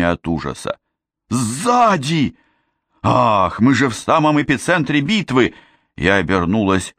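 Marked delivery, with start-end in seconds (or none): 1.3-5.5 high, panicky outcry; alarmed warning. A narrator's reading. Frightened boy warns of attack in battle, high alarm. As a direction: warn in alarm, pitch jumping high.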